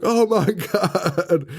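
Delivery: high, drawn-out mock horror; mock freak-out. Courtney finally seeing the body, hammed-up freak-out. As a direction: stretch it into a hammed-up panicked cry. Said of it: "she finally freaks out".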